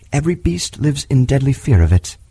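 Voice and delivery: ordinary delivery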